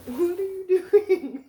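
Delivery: high, loud, slow pet talk; amused at the dog. Real, not acted. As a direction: tease the dog playfully. Dog in the box.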